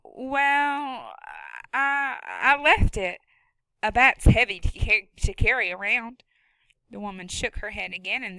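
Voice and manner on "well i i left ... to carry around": put-on higher, hurried scared boy; a boy trying to talk his way out; nervous boy. Boy character voice.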